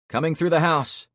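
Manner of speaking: loud call through the house. Acted. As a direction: call out so people inside hear. Calling into a shot-up house.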